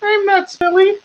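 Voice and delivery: high, faint squeak from inside a barrel; trapped troll squeaking faintly. Acted. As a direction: say it small and high, as if far off. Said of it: "really faintly, you hear from the troll".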